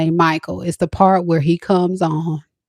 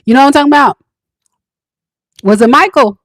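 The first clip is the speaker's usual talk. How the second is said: high, loud excited call; excited shout; 8 semitones higher, 8 dB louder, much faster than usual. Real, not acted. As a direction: yell it out thrilled.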